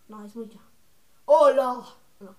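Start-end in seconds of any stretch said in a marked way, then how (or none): 1.2-1.8 loud put-on hello; Daniela. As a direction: belt out a mock character greeting. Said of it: "usar la voz de Daniela así".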